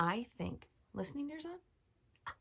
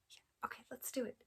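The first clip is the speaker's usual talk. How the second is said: whisper; 4 semitones higher, 8 dB quieter than usual. Acted. Whispered before counting.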